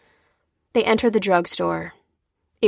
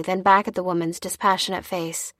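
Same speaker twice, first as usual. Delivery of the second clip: ordinary delivery, nothing marked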